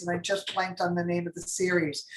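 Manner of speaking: usual speaking voice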